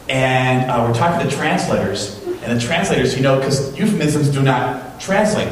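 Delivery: ordinary talk, nothing marked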